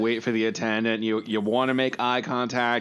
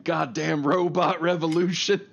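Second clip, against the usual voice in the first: high-pitched cranky complaint; mock angry grumble; 6 semitones higher than usual. Customer ranting about the robot checkout, mock angry grumble. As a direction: rail at the machines in mock outrage. Acted rant at machine.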